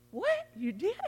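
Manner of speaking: high-pitched mock disbelief; retold surprise. Pastor hears a church member's report, retold surprise. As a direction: leap up high on a startled question, replaying it.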